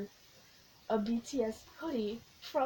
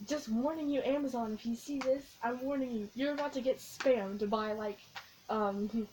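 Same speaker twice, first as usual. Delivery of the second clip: ordinary delivery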